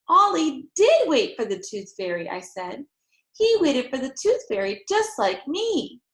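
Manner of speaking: put-on loud, eager child voice; excited explaining. A narrator's reading. Girl hugging mother, excited. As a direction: tell it breathlessly proud.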